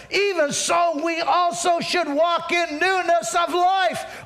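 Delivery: high, building scripture recitation; full-force preaching. Acted. Pastor reciting Romans to the congregation, full-force preaching.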